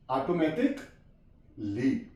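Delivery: ऑटोमेटिकली drawn out slowly and low, rising at the end; slow model word. Shown as a demonstration. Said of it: "यहाँ पे भी ए silent है". (ऑटोमेटिकली धीमे और साफ़)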